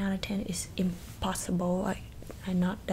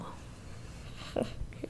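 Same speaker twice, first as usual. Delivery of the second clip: soft laugh; 11 dB quieter than usual. Acted. Laughs at own insult.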